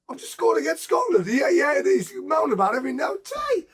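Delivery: high-pitched comic protest; mock exasperation. Scorer protesting to mum about dad, high and exasperated. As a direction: complain up high, in disbelief.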